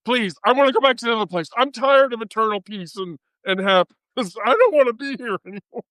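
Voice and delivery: high, fast, pleading whine; a mock soul whining to be sent back; whiny plea to leave heaven. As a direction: beg like a bored soul stuck in paradise. Voicing a soul stuck in heaven.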